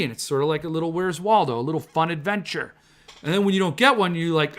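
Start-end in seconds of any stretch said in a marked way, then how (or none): none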